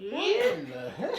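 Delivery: high, laughing disbelief; surprised laugh. Real, not acted. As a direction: laugh it out in shock. Sister says it tastes like perfume.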